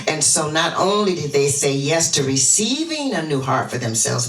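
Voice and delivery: ordinary talk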